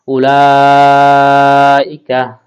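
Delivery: উলাইকা with the la drawn out long and slow; long stretched la. Shown as a demonstration. Teacher slowly models a long vowel for students. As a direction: stretch the vowel of উলাইকা well past a beat. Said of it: "লাটা আমাদেরকে ছাড়ে টেনে পড়তে হবে".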